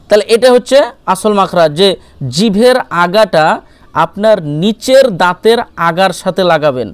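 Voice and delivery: usual speaking voice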